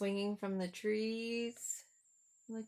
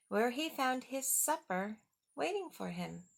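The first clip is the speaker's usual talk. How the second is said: louder storybook narration; dramatic storybook reading; 5 dB louder than usual. A narrator's reading. Reading the monster farewell to the kids, dramatically. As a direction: make the roars sound big. Reads picture book aloud.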